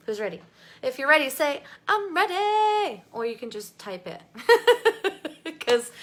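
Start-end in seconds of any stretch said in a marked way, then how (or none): 1.9-3.0 high, loud, drawn-out "ready"; big goofy high-pitched cheer; playful tone. Shown as a demonstration. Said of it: "how you would say it with that tone". 4.3-5.5 loud, high giggle; quick burst of high laughter; amused laugh. Real, not acted. Laughs at own silly tone.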